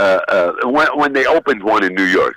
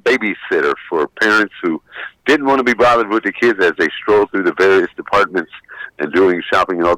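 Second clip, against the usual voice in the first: ordinary talk, nothing marked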